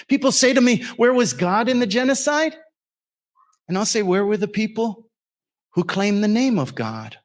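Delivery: revival-style sermon cadence; preaching. Acted. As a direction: preach it out at full force. Continues preaching.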